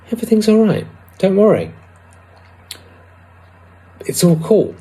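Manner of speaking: a tiny inner voice saying all is well; tiny reassuring voice. Retold or imitated. Inner little voice soothing her, high and tiny. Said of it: "a quiet little tiny voice".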